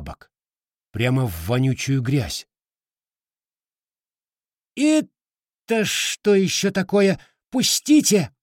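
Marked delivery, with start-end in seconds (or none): 4.7-8.3 very high, slow, panicked squeal. Acted. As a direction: squeal like a trapped creature. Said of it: "Писк и визг Рыжетопа".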